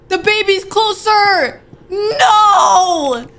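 high, loud, slow crying shout; panicked shout. Real, not acted. Gamer yelling as the baby creeps closer, panicked shout. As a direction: scream it out in real panic.

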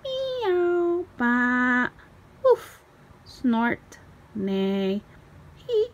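high, loud made-up animal calls; animal noises. A narrator's reading. Children make up swan noises, loud squeaky animal calls. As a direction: yelp out silly animal sounds. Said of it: "make up their own".

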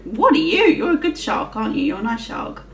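ordinary delivery

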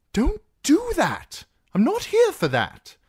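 high-pitched, alarmed objection; loud, squeaky-high protest; flustered protest. Acted. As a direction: fend her off, voice shooting up. Sam fends off the woman's embrace.

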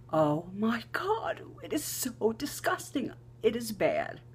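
disgusted exclamation. Retold or imitated. Reacting again to the smell.

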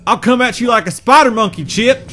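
loud, high hammed-up threat; movie quote. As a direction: ham up the movie line. Quoting movie line.

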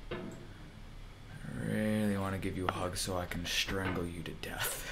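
menacing whisper. Real, not acted. Gamer to Bennett Foddy, whispered menacing hug threat.

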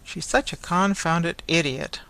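plain reading voice

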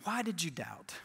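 hushed, low-pitched pondering; quiet rebuke. Acted. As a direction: repeat it softly, letting it sink in. Repeats the question more softly.

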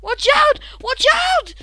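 high, loud warning calls; calling out. Real, not acted. Warning a teammate in the game, high loud call. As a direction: yell to warn them.

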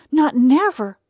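put-on high, loud childlike vow; eager promise. A narrator's reading. Eddie vowing to Tom he won't tell. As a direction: swear it with all his heart.

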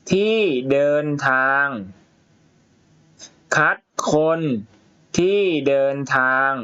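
a teacher reading a story to the class, word by word; reading to children. Shown as a demonstration. Reads story aloud to pupils.

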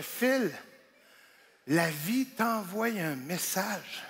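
slow, soft self-talk; inner voice. Retold or imitated. Comic talking to himself in the car, soft and slow. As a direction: tell yourself gently, very slowly. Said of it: "je fais".

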